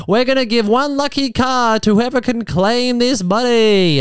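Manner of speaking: a lightly voiced breakfast radio host. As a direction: announce it like a prize giveaway. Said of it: "Kyle Sandilands steps in and goes".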